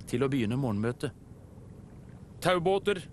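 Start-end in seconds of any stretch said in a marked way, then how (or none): 2.4-3.0 higher, mildly worried call to the group; a touch of worry. A narrator's reading. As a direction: address everyone with some concern. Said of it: "sa han med bekymret stemme".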